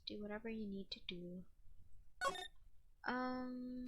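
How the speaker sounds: ordinary talk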